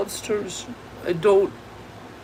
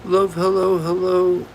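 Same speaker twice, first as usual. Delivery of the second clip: normal talking voice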